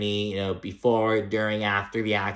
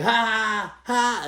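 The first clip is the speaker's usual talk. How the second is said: much higher, loud imitated cackle; mock loud laugh; 11 semitones higher than usual. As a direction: ham up a brash, obnoxious laugh. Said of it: "She's being all loud".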